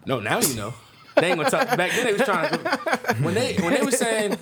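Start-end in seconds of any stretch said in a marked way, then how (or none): 1.2-4.4 laughing through the words; chuckling while talking. Real, not acted. Laughing while telling history.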